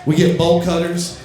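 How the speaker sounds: usual speaking voice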